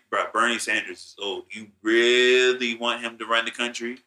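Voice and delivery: normal talking voice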